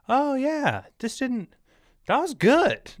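very high falsetto, mock-polite fake compliment; imitating guests lying about liking the food; insincere praise. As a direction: squeak out unconvincing, over-eager praise. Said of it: "it sounds like they're lying".

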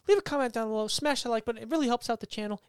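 lightly voiced channel sign-off; outro voice. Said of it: "I was very soft-spoken".

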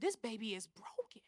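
soft, acted whisper of realization; hushed, breath-only retelling; whispered heartbreak. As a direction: whisper it with aching pity. Retelling her realization about the child.

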